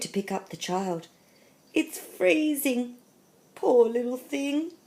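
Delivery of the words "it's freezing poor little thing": high, drawn-out cooing sympathy; pitying concern. A narrator's reading. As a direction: coo over a poor cold baby. Man pities the freezing baby.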